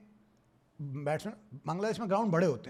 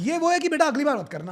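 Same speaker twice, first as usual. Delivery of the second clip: higher, louder laughing taunt; mock warning; 7 semitones higher, 5 dB louder than usual. Fan laughing, mock-warning the batsman again. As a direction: scold the batsman while laughing.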